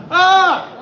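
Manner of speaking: a sudden very high, loud shriek; wild scream. Shown as a demonstration. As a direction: let out a piercing scream on cue. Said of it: "One more time".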